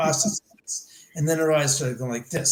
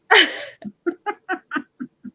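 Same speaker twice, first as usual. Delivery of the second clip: high, loud laugh; burst of laughter; 4 dB louder than usual. Real, not acted. Amused by the date's reply, a high burst of laughter. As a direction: break into a big laugh.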